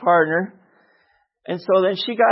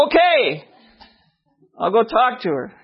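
exasperated high "okay", then settling; upset giving in; 5 semitones higher than usual. Acted. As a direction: blurt it out, fed up with the nagging. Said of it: "I got upset and I said".

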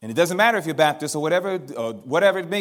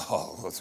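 low breathy chuckle of delight; hushed laugh at an easy opening; soft delighted laugh; 6 semitones lower, 4 dB quieter than usual. Real, not acted. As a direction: laugh quietly, thrilled. Delighted by stranger's question.

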